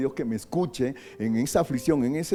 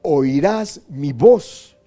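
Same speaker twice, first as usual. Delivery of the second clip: louder and higher, slow pleading; full-force prayer; 4 semitones higher, 8 dB louder, slower than usual. Shown as a demonstration. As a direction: cry out the prayer.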